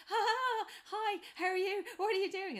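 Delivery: put-on shy guy hamming up a hello to two girls; nervous laugh greeting. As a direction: laugh awkwardly before speaking. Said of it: "all he does is just, like, laugh first".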